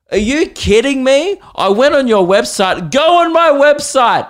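high, loud incredulous rant; exasperated. Real, not acted. Comedian ranting to crowd about the troll, loud and incredulous. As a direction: wind up in disbelief at the troll.

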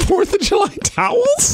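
high, slow disbelieving question; incredulous. Real, not acted. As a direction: rise in disbelief at "towels". Incredulous echo of gift.

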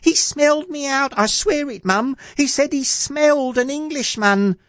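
very high, insistent squeak; alarmed boy. Acted. Jack swearing to Mum the giant smelled him, alarmed boy. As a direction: protest in a piping lad's voice.

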